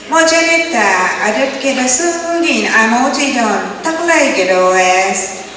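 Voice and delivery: high, lilting Creek woman's voice; Creek woman chatting. Retold or imitated. Creek woman telling her friend about her work, high and lilting. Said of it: "this is the way Creek women talk".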